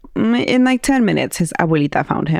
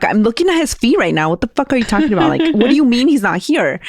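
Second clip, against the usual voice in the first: higher-pitched incredulous protest; mock exasperation; 6 semitones higher, faster than usual. Sister argues with dad about the feet, mock exasperation. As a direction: imitate someone baffled and arguing back. Acted argument over missing boy.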